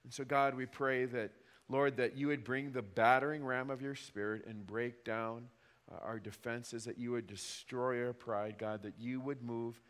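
soft, low earnest prayer; closing prayer. Acted. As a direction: pray it quietly and humbly. Pastor leads closing prayer.

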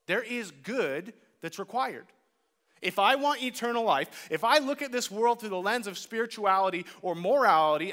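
ordinary talk, nothing marked

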